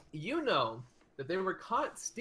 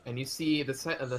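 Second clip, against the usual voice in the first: ordinary talk, nothing marked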